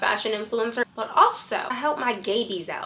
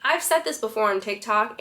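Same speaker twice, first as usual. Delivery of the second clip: normal talking voice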